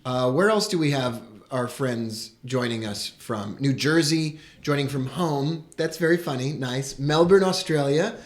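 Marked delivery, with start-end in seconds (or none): none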